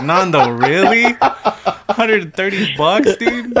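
high, loud laughing incredulity; drawn-out, high-pitched ribbing through laughter; mock disbelief. Teasing a friend in the group chat over his excuse, loud laughing disbelief.

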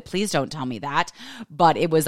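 normal talking voice

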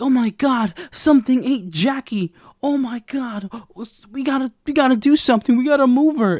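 high, loud frantic panic; a hammed-up panic over a dead friend; panicked. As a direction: shriek it out in a frantic rush. Thaisa panicking over eaten friend.

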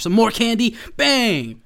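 loud, high and punchy; gleeful mock shout. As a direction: act out a triumphant candy slam. Acts out dumping more candy triumphantly.

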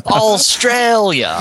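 drawn-out, high-pitched Australian accent. Shown as a demonstration. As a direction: put on an Australian accent, stretched slowly. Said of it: "I can say in an Australian accent".

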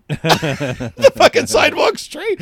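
laughs the words out, high and slow; cracking up while telling the punchline; laughing. Real, not acted. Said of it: "I kinda laughed".

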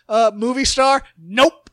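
loud, blunt mock refusal; imitating a wrestler turning down the movie star gimmick, loudly. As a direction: bark the Nope as the wrestler. Bob Holly rejecting gimmick.

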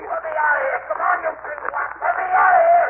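high, raging shout to be freed; furious at being locked in; angry demand. Acted. Locked-in secretary angrily shouting at Gildersleeve.

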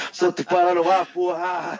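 high, hammed-up echo of the catchphrase; mock catchphrase echo. Co-host teasing the guest by parroting his catchphrase, high mock voice.

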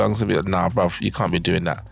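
ordinary talk, nothing marked

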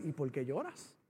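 very low, hushed question; imitated gentle divine voice; voice of God. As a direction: ask softly and deep, with calm patience. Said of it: "me volvió a decir".

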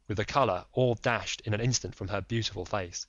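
ordinary delivery, nothing marked

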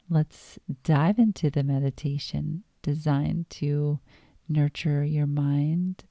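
ordinary delivery

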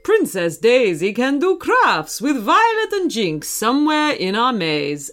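reassuring cartoon hero, put-on voice; Mario. Mario tells Archie not to worry, hearty character voice. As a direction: reassure him in a lilting game-hero voice.

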